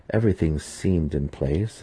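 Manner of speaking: plain reading voice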